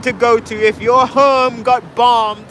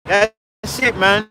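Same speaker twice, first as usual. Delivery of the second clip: normal talking voice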